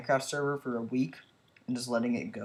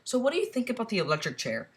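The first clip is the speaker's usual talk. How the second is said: high silly voice; 10 semitones higher, faster than usual. Pretend. Silly put-on question.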